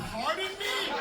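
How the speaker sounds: high, startled pardon me; mock-offended surprise; shocked pardon. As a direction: jump up in pitch, caught off guard. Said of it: "I was like".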